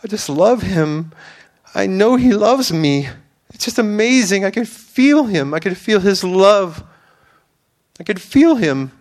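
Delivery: a woman on the brink of tears, lightly imitated; imitating weeping. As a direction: echo her near-tears praise, overcome. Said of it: "basically almost weeping and crying".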